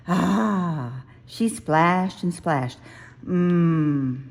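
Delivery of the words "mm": happy mm. A narrator's reading. Bear splashing in river.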